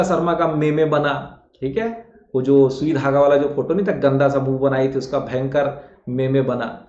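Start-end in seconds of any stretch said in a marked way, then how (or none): none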